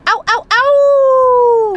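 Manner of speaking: slow high howling "ow, ow, ow"; puppy howl. Retold or imitated. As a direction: howl it out like a pup. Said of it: "You gonna howl for us?".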